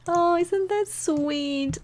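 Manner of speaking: slow high cooing; aww. Real, not acted. Touched by the tired sim.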